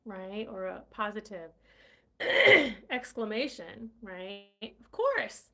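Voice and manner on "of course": much higher and louder, eager; eager Terrence, quick and keen; quick eager reply. Acted. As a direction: jump in eagerly. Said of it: "Terrence said quickly".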